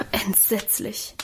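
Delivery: slow, soft sigh of dismay; hushed, drawn-out sympathetic sigh. A narrator's reading. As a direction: breathe it out as a long sigh. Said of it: "seufzte Adele".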